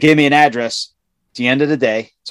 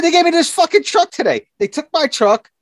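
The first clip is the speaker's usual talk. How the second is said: high, raised indignant protest; retold complaint. Griping to the foreman about the swapped truck, high and heated. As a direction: complain in a much higher, worked-up voice. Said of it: "I said".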